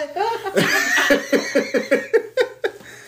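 high wheezing laugh. Real, not acted. Telling the riot story, high wheezing laugh.

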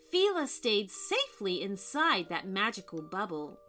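usual speaking voice

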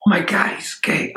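breathy whispered realization, unvoiced; shocked whisper. Storyteller whispers his shocked inner thought. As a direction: whisper it as the penny drops.

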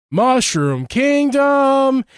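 high, slow lazy drawl. Retold or imitated. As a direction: drag the words out like you barely care. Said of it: "it sounds lazy".

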